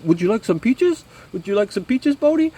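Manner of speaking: gently offering peaches in a high, mock Swedish lilt; mock Swedish accent. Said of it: "I can't even do his accent".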